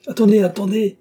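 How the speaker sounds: high, loud urgent call; crying out. A narrator's reading. Baron stops his daughter on her way out, crying out. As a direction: cry out to stop someone leaving. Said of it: "cria-t-il".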